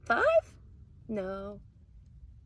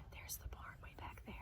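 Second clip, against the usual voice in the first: whisper; 20 dB quieter, much faster than usual. Acted. Reading bedtime story to kids, hushed whisper. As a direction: whisper so nobody wakes.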